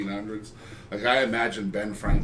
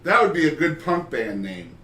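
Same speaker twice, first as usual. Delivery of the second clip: normal talking voice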